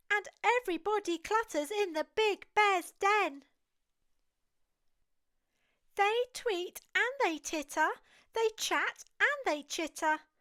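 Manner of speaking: ordinary delivery, nothing marked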